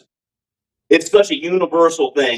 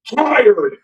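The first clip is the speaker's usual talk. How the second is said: put-on JoJo Philly accent; 8 semitones higher than usual. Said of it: "I do his perfect Philly accent too".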